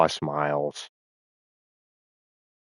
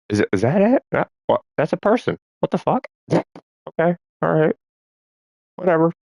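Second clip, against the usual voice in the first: puzzled bear voice; 11 semitones higher than usual. Retold or imitated. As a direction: play the confused bear sizing up a strange human.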